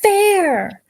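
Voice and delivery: put-on high, slow whining girl; whiny girl. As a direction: drag it out like a sulky girl. Imitating Labyrinth girl.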